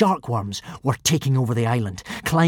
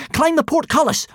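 ordering; 12 semitones higher, 3 dB louder than usual. A narrator's reading. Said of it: "ordered Hiccup".

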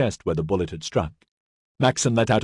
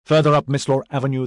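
usual speaking voice

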